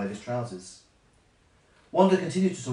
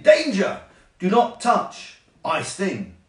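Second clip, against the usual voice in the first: loud, high alarm call; urgent danger warning; warning cry; 6 semitones higher, 7 dB louder, slower than usual. Acted. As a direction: shout it out as a warning, falling off at the end. Nature's warning voiced as words.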